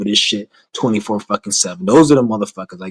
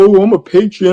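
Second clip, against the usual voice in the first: mock patriot; 9 semitones higher, 5 dB louder than usual. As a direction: puff up and sneer it like a flag-waver. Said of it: "They go".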